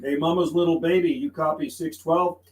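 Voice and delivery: ordinary talk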